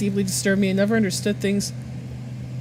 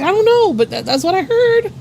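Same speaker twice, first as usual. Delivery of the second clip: high, louder mock protest; flustered defence; 10 semitones higher, 5 dB louder than usual. Worker explaining to coworker what they misheard, flustered. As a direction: plead innocence in a raised pitch.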